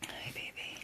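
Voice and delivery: soft whisper to a sick dog; tender whisper. Real, not acted. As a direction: breathe the greeting gently to the dog.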